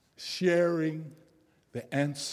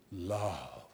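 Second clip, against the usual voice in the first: tender reveal; 9 semitones lower, 8 dB quieter than usual. A narrator's reading. Story's revealed answer.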